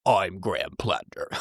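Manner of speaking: weak attempt at a deep-voiced candidate; failed deep voice. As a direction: try and fail at a deep manly voice. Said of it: "I can't even do a deep voice".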